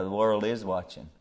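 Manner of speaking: ordinary talk, nothing marked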